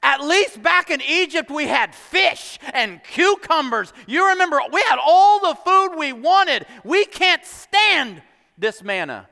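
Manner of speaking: high, exaggerated group grumbling; grumbling complaint. Israelites complain to Moses about the manna, grumbling loudly. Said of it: "began to grumble and they began to complain".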